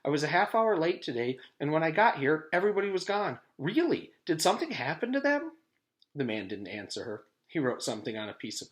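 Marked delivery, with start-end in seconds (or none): 0.0-5.5 high, pleading, rising; upset schoolgirl. A narrator's reading. Jenny pleading with the stranger, high and anxious. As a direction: beg for an answer.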